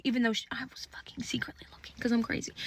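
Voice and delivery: whispered guilty aside; conspiratorial whisper to viewers. Real, not acted. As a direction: let it slip under your breath. Confessing aside about secretly watching.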